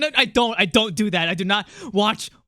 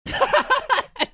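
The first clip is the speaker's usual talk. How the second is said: high burst of laughter. Real, not acted. Laughing at own voice.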